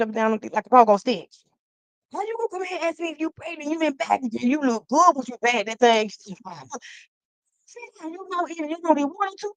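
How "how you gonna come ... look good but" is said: high, fast put-on scold; high-pitched mock telling-off; sassy scold. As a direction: repeat it in a higher mocking voice. Same scold repeated.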